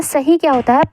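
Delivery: ordinary talk, nothing marked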